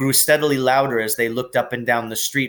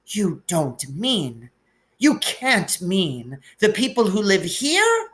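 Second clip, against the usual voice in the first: crying out; 8 semitones higher, slower than usual. A narrator's reading. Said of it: "cried Professor McGonagall".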